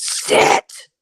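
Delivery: sharp unvoiced burst, one syllable; imitated disembodied voice. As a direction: spit the word out like an eerie voice. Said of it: "this voice that goes".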